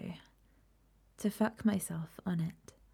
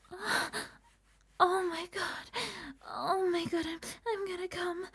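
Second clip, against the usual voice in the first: higher, breathless moaning pleas that rise in intensity; building to climax; 8 semitones higher than usual. Acted. Begging her partner through the countdown toward climax. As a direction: let the voice climb and break into cries as she nears the end.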